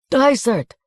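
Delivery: loud, high call of a name; shouted call for Dysart. A narrator's reading. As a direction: call the name across the yard. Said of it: "called the man behind him".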